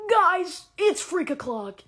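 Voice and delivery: loud, excited party call; hyped-up announcement. Retold or imitated. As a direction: announce it like a hype girl. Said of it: "What do you mean I'm going".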